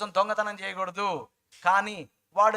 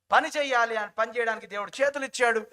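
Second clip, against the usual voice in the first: ordinary talk, nothing marked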